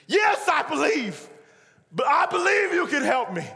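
high, loud avowal; desperate shouting. Acted. Dangling man answering the voice above, desperate shout. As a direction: shout it up as if life depends on it.

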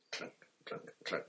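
breathy, fading footsteps; almost voiceless, drifting away; whispered. A narrator's reading. Steps fading up the stairs.

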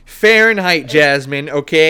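loud high defensive insisting. Real, not acted. Defensive correction.

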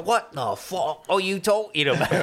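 hammed-up bad British accent; Alan Rickman. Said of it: "that was a bad impression of him".

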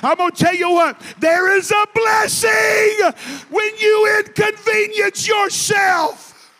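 preacher crying out the point at full force; preaching shout. Real, not acted. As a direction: build to a shouting climax. Sermon climax, preacher shouting.

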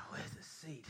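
whispered grumble; annoyed guest mutters; grumbling whisper. Retold or imitated. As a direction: hiss it under your breath.

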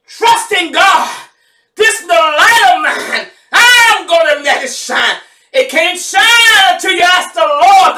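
high, loud celebratory preaching; preaching at full force. Acted. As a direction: shout the promises over the congregation. Final celebratory sermon climax.

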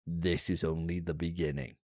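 lightly voiced gruff soldier line; Solid Snake. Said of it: "he says".

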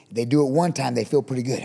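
ordinary talk, nothing marked

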